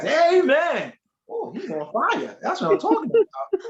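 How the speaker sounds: high, loud churchy exclamation; too-loud amen. Retold or imitated. Churchgoer showing off to get her notice, too loud. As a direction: imitate an overeager amen from the pews. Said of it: "saying amen too loud".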